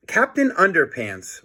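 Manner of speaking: plain reading voice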